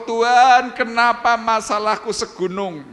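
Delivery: high, loud, slow whining to God; mock the grumbler's wail; whining complaint. (meniru keluhan merengek dengan suara tinggi)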